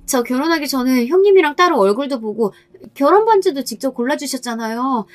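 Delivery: put-on high, tearful pleading voice of a young wife; begging sister-in-law. (높고 애원하는 막내 동서 목소리)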